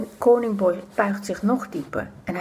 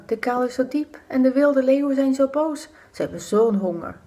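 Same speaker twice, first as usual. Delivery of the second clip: plain reading voice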